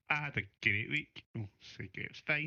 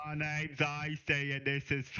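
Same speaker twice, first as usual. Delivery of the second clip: slow, higher show intro; 6 semitones higher, slower than usual. Retold or imitated. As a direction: announce it like a host. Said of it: "he's been saying that to himself".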